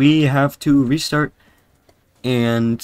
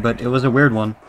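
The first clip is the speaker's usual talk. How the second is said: normal talking voice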